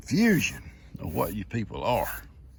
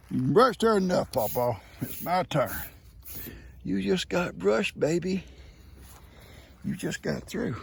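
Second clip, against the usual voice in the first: higher jealous goat voice; 7 semitones higher than usual. Acted.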